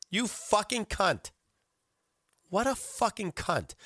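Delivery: slow, high-pitched cursing; disgusted outrage. Real, not acted. Host cursing the greedy diner, disgusted outrage. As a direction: stretch each curse out in indignation.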